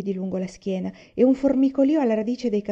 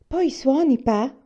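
higher, louder eager asking; child's keen, raised-voice question; eager request; 6 semitones higher, 6 dB louder, slower than usual. A narrator's reading. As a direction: ask brightly, hoping for a yes. Child eagerly asks father to play.

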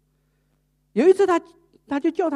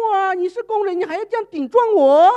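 high, loud outraged yelling; furious scolding; 10 semitones higher, 10 dB louder than usual. Retold or imitated. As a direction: yell at the maid in outrage. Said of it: "就更骂了哦". (更加愤怒地大骂)

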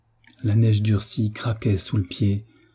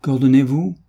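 louder defiant answer; same loud tone; 4 semitones higher, 6 dB louder than usual. A narrator's reading. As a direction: shout it back at the master. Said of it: "sur le même ton".

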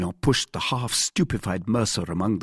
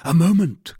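high, loud call to halt; cried out; 10 semitones higher, 8 dB louder than usual. A narrator's reading. D'Artagnan checks his friends drawing swords, crying out. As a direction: call out sharply to stop them. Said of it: "cried D'Artagnan".

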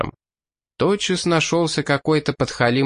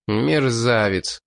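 usual speaking voice

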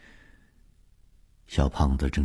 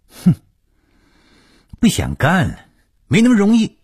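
high, loud, indignant outburst; snort, then curse at full pitch; cursing angrily; 7 dB louder than usual. Acted. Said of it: "骂着". (气急败坏地高声咒骂)

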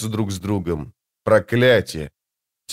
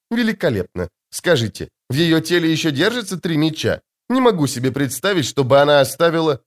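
much higher, light and airy lady's voice; sweet woman's voice; 5 semitones higher than usual. Acted. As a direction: play the serene, otherworldly beauty.

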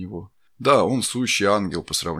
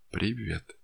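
soft greeting; 2 semitones higher, 7 dB quieter than usual. Acted. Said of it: "хорошо знакомым голосом сказал убийца".

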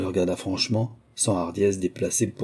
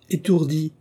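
higher-pitched motherly chiding; a touch of reproach in a raised pitch; mild reproach; 8 semitones higher, 3 dB louder than usual. A narrator's reading. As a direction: scold lightly, as a mother would. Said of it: "fit la mère avec un peu de reproche".

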